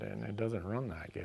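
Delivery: normal talking voice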